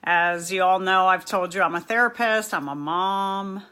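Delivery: ordinary talk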